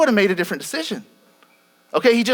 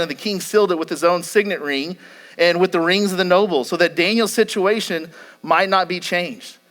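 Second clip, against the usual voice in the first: normal talking voice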